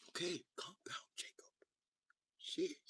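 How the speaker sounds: soft unvoiced whisper; frightened whisper. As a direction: hush it nervously. Whispered fearful aside.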